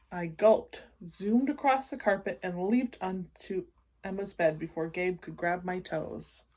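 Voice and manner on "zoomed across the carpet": plain reading voice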